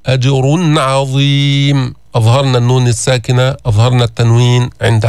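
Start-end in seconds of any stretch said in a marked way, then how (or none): 0.1-1.9 أجر with a full heavy ر, the tanween clearly sounded before عظيم; slow أجر عظيم with a clear nun. Shown as a demonstration. Teacher drills the clear nun before ع. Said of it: "فخمنا الراء، جبنا الإظهار".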